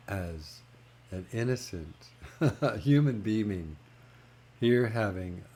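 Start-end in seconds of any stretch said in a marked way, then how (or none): 2.2-2.8 brief light laugh; real chuckle. Real, not acted. Speaker describes us as innocent humans, brief real chuckle. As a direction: let a small laugh slip mid-phrase.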